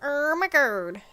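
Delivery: delighted pun delivery; savoring the pun. Shown as a demonstration. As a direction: relish the joke in "Irma Gerd". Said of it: "savoring the pun name".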